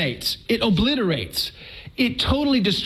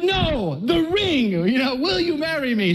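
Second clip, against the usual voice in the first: higher, laughing correction; half laughing, steer her back to the ring; mock exasperated; 7 semitones higher than usual. Husband correcting his wife during proposal, high laughing exasperation. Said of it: "I'm like".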